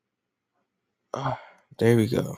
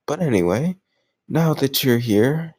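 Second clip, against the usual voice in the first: usual speaking voice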